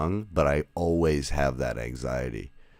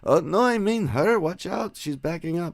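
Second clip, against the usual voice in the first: put-on elderly lady voice; 14 semitones higher than usual. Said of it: "And she goes".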